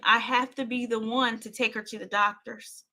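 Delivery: ordinary delivery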